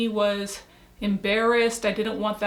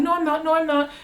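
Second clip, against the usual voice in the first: mildly defensive, higher-pitched denial; defensive protest; 5 semitones higher, faster than usual. Retold or imitated. Denying to coworker that she is angry, mildly defensive. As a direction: push back a little, as if retelling it.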